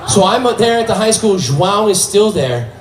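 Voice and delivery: normal talking voice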